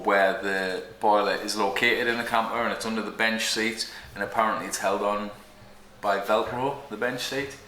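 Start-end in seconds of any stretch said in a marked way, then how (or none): none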